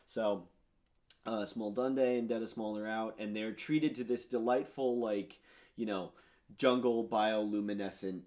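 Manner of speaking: usual speaking voice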